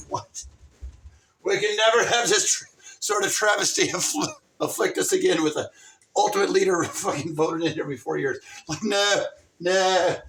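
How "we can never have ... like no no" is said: higher and louder ranting; hammed-up indignant rant; mock outrage. Ranting at voters who repeal term limits, loud.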